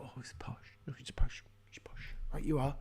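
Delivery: hushed mock deference; awed whisper. Meatheads notice someone is posh, mock awed whisper. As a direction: whisper it as if cowed and impressed.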